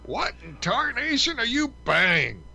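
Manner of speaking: high, slow cartoon prospector holler; cranky old cowboy cartoon yelling; Yosemite Sam. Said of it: "your imitation of Yosemite Sam".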